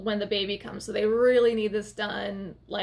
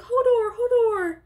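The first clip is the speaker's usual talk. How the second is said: much higher, louder, slow excited call; 11 semitones higher, 5 dB louder than usual. Retold or imitated. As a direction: call his name thrilled. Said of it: "I was so excited".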